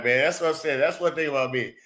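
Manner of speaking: ordinary delivery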